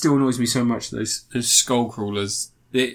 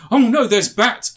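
high, loud send-up of panic; mock alarm; 9 semitones higher, 5 dB louder than usual. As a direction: cry out in hammed-up dismay. Mocking film character's obvious alarm.

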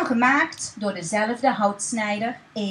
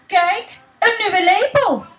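excited; 6 semitones higher, 7 dB louder than usual. Acted. Baker shows wife a spoon.

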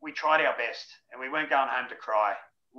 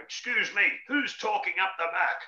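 higher, mock prissy teacher scolding; prim schoolteacher; 7 semitones higher than usual. Ex-teacher coach hushing players at the back, higher prim mock scold. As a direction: play a fussy teacher hushing the class. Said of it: "that's what a school teacher would say".

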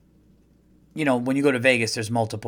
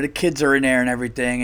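usual speaking voice

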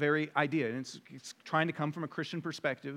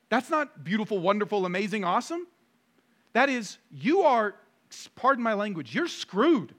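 raised pulpit voice, building to a punched last word; preacher hammering out a verdict; emphatic preaching; 6 semitones higher, 8 dB louder than usual. Acted. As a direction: drive the point home, higher and forceful. Preaches at rising force.

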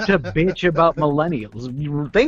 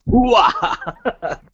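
loud gung-ho soldier; 6 semitones higher than usual. Retold or imitated. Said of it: "Al Pacino going".

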